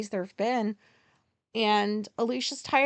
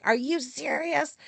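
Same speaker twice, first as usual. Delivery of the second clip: higher, drawn-out disbelief; incredulous; 5 semitones higher than usual. Retold or imitated. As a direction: challenge her, stretching the question out. Retold disbelief at friend.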